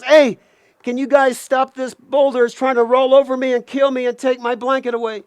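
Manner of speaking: high, urgent call for help; scared plea. Acted. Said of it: "Now Coyote's really scared".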